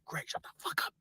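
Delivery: harsh, voiceless warning; desperate whispered shushing; urgent whisper. Retold or imitated. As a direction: whisper it so the next table can't hear. Hissed warning to friend.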